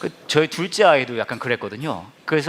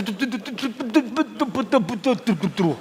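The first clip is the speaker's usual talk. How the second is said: imitated engine sputter; 6 semitones higher, much slower than usual. Engine dying while restarting.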